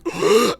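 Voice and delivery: sharp acted gasp on waking; sudden intake of breath, hammed-up; startled gasp. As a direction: gasp as if jolted from sleep. Acts characters waking up startled.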